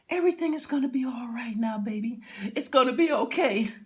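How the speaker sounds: wife calms her husband on gurney; soothing wife. Retold or imitated. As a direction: comfort him gently, high and quick.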